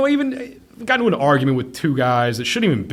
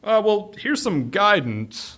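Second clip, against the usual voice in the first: mock wise; 10 semitones higher, slower than usual. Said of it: "their infinite fucking wisdom".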